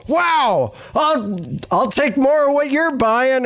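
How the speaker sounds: hammed-up amazed exclamation; Letterman. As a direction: imitate a host bowled over by a performance. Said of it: "then to see Letterman go".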